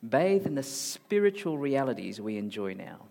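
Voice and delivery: normal talking voice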